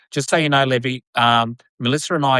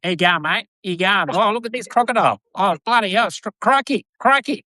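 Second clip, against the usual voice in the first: higher, hammed-up Aussie ocker voice, rising on crikey; strong Australian accent; 7 semitones higher than usual. Imitating Steve Irwin for the co-host, in a hammed-up Australian accent. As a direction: play a broad Australian bloke spotting a crocodile. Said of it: "a really strong Australian act".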